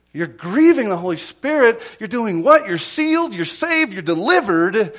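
loud, high, impassioned sermon delivery; full-force preaching. Real, not acted. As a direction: hammer it home to the congregation.

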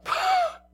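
a big booming scornful laugh; mocking laugh. Drunk giant laughing off the cop's hit, booming.

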